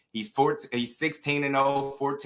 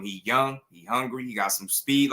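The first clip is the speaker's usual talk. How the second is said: ordinary talk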